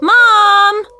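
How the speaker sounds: loud high shout down the hall. Acted. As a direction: yell for mom. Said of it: "the moment I shouted".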